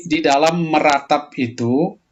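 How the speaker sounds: ordinary talk, nothing marked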